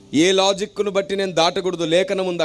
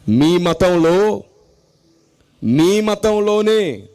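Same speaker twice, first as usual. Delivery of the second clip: మీ మతంలోనే drawn out very slowly and louder; emphatic slow; 3 dB louder, much slower than usual. Acted. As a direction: weigh each word of మీ మతంలో. Preacher stressing a point.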